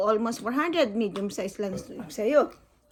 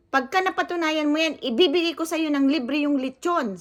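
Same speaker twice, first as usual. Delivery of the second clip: higher, louder mock boast; imitating the owner; 6 semitones higher, 5 dB louder than usual. Vlogger mimics owner promising a free lechon, louder. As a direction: ham up the owner's rash promise. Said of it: "Sabi niya sa akin".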